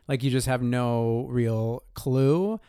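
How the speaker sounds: ordinary delivery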